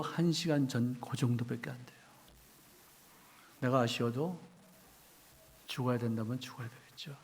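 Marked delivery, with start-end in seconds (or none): none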